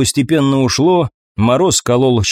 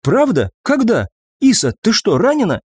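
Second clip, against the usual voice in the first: startled questions; 8 semitones higher than usual. A narrator's reading. Startled questions to wounded comrade.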